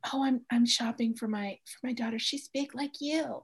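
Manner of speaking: lightly voiced condescending mother; a hint of a patronising mom; imitating a mother shopper. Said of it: "will come up to me and go".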